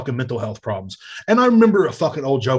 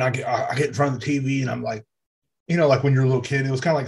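usual speaking voice

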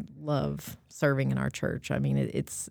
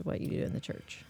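whisper; 14 dB quieter, faster than usual. Real, not acted. Aside whispering to husband.

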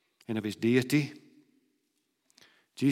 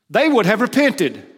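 emphatic declaration; 8 semitones higher, 9 dB louder than usual. Acted. Emphatic repeat of Jesus' words.

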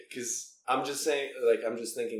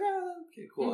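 surprised. Retold or imitated. Surprised at a girl's note.

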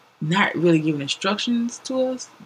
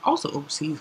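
ordinary talk

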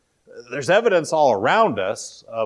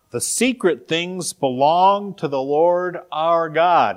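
slow, louder, higher scripture reading; 5 semitones higher, 5 dB louder, slower than usual. Acted. Preacher reads Deuteronomy to the congregation, slow emphatic scripture reading. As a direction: read the verse out slowly and emphatically.

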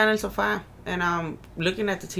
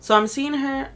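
normal talking voice

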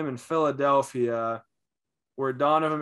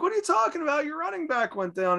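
much higher, a sneering imitated fan; mocking retort; 13 semitones higher, faster than usual. Drunk fan defending his taunt, in a high sneering voice. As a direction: toss it back like a smug drunk fan. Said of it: "He's like".

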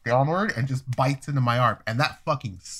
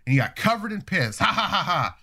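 higher, laughing taunt at the pissed-on guy; mocking laughter; 5 semitones higher than usual. Teen imagining the crowd laughing at his victim, mocking laughter. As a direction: laugh at him like the whole crowd would. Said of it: "people are gonna be like".

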